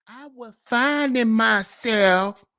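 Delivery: slow, drawn-out mock whine; lightly hammed-up mockery. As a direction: stretch it like a self-pitying excuse. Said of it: "modern day man talk".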